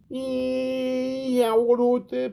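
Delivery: put-on high, broad Birmingham accent; Brummie accent. Manager greets interviewer Pork Pie, broad Brummie accent. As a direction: greet him in a hammed-up Brum accent. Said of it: "What the hell is that voice?".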